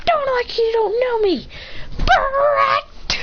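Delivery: put-on high-pitched childish whine; bratty kid. Kid snaps back in story.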